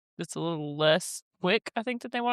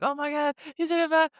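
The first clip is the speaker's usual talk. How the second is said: higher-pitched mock alarm; imitating panicked caller; 8 semitones higher than usual. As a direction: act out a frightened caller on the radio. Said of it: "scared to death".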